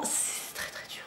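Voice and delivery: breathy whisper, dropping to almost nothing; whispered aside. Real, not acted. Vlogger to viewers about her yarn, mock-dramatic whisper. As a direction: confide it under your breath like a mock complaint. Joking that knitting is hard.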